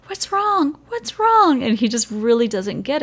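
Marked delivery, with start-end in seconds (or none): 0.1-0.8 put-on worried kid voice. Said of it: "the kid says". 0.9-1.6 put-on a higher, louder repeat of the kid's question; worried kid voice. Kid asking the crying tutor, higher and louder. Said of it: "the kid says".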